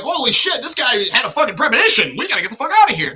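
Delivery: high, loud urgent alarm; alarmed reaction. Acted. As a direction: blurt it like a spooked passenger rallying others to run. Acts out onlookers' alarmed reaction.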